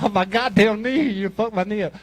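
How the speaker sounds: high mock cry of pain; imitating a hurt girlfriend's protest. Acts girlfriend yelling about hurt knee.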